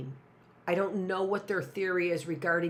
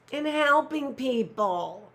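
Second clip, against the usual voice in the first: mock noble motive; 8 semitones higher, 6 dB louder, slower than usual.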